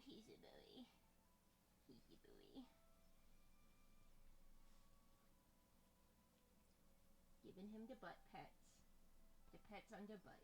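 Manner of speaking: very soft murmur sliding into a whisper; hushed pet talk. Real, not acted. Giving the dog butt pets, hushed murmur to whisper.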